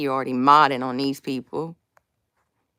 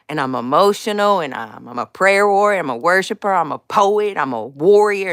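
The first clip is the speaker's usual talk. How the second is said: usual speaking voice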